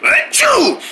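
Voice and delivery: very high mock "achoo"; pretend sneeze. After hiding it from Mom, pretend sneeze. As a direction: let the held-in sneeze burst out, hammed up. Sneezes once mother has left.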